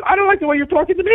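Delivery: high, sing-song mimicking echo; mocking echo. Driver mocking the dispatcher's words right back at him, high. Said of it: "I said".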